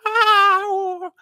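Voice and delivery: loud, very high sustained "wow"; high wail. Retold or imitated. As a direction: imitate a stage singer's long piercing wail. Imitates singer's long high wail.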